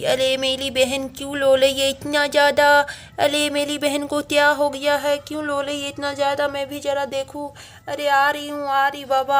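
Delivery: put-on high, lisping baby-talk little-girl voice; little girl Amayra, concerned. Little sister calling out to mummy that she is coming, pretend high child voice. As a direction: play a small child fretting over a crying sister.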